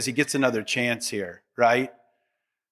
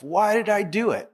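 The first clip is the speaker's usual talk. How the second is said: kicking himself; 5 semitones higher than usual. Retold or imitated. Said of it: "Just kicking himself".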